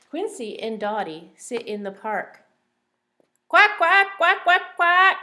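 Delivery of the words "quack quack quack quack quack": loud, high squawking duck voice; shouting duck. Acted. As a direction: belt it out like a noisy duck. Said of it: "shouts Dottie".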